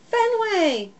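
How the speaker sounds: high, alarmed call of the dog's name; yelled name. A narrator's reading. Girl yelling at her dog in the backpack. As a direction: call him off sharply. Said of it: "Hattie yells".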